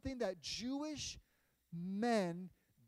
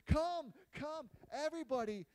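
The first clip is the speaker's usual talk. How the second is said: higher, louder cheerful call; 5 semitones higher, 4 dB louder than usual. Acted. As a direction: summon everybody from the street. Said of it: "She's making noise".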